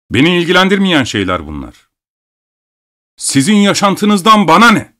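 high, indignant outburst; angry shouting. A narrator's reading. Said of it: "Doktor bağırmayı sürdürüyordu".

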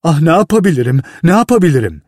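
rising panic, no way out; terrified boy by the burning fuse; panicked. Acted.

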